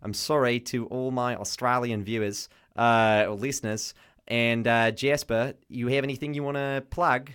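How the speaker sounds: hammed-up Australian accent; mock Australian radio host. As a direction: put on an Aussie accent for the sign-off. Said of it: "didn't use my Australian accent enough".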